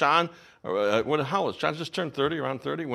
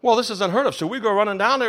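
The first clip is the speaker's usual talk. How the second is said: ordinary delivery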